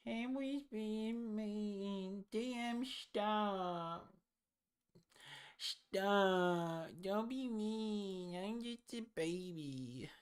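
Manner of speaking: put-on high, slow whiny baby voice; mock baby whine, high and drawn out. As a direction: plead for mercy like a tiny baby.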